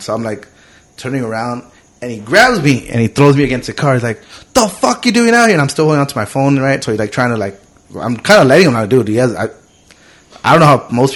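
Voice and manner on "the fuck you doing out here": high, forceful imitated demand; heated mock police challenge; aggressive cop. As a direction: confront him like an angry cop, pitched way up. Cop throws him against car.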